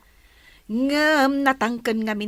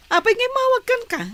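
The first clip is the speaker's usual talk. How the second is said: alarmed; 13 semitones higher, 5 dB louder, much faster than usual. Acted. Friend alarmed Marvin is visiting.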